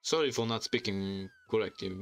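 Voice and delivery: ordinary delivery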